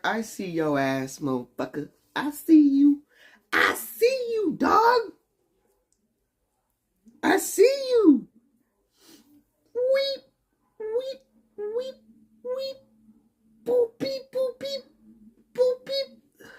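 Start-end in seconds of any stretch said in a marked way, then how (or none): 2.2-8.3 much higher teasing sing-song; playful high-pitched I see you; high taunt. Pretend. Taunting a viewer named Savage Shark. 9.8-16.5 very high beeping robot noises; high, squeaky robot beep-boop. Pretend.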